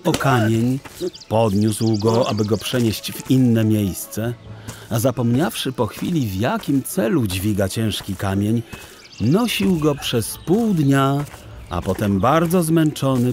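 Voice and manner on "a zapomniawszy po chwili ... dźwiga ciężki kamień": ordinary delivery, nothing marked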